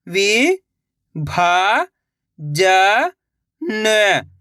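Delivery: "विभाजन" drawn out very slowly by syllable; sounding out slowly. Shown as a demonstration. Teacher sounding out a word for young readers. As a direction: stretch "विभाजन" into slow parts. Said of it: "एक अक्षर का उच्चारण करके".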